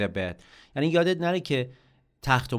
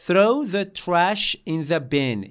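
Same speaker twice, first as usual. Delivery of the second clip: loud slow model; 5 semitones higher, 5 dB louder, much slower than usual. Shown as a demonstration. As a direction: Throw the trash in the bin, louder and slow. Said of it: "model sentence for learners".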